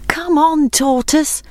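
high, worried urging; anxious calling. A narrator's reading. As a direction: call high to a friend falling behind. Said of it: "called his friends anxiously".

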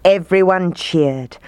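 usual speaking voice

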